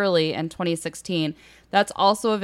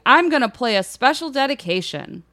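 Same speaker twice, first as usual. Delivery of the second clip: [name], excited; 7 semitones higher, 4 dB louder than usual. A narrator's reading. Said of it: "Iggy got all excited and told everybody".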